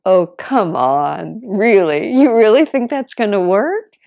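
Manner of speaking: loud, amused mock incredulity; amused disbelief. Jesus teasing the doctor, loud amused disbelief. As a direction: tease him like Jesus would.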